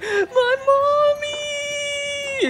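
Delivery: put-on very high wailing child voice; tearful, drawn-out squeal of a small girl; crying little girl. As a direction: wail it like a lost five-year-old. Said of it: "you hear her crying".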